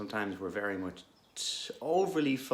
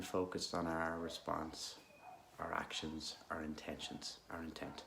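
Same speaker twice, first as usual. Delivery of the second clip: calm, unhurried guidance with long pauses; guided meditation; 2 semitones lower, 6 dB quieter than usual. Real, not acted. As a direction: gently guide listeners into stillness.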